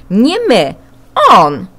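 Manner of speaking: loud, insistent pointing-the-finger cry; blaming shout. Acted. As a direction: loudly shift the blame. Children blame each other. (głośne, oskarżycielskie „Nie my, on!”)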